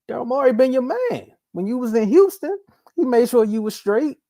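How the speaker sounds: high-pitched mock lecture to Harden; lightly voiced pleading friend; mock scolding. Hosts lecture Harden in character.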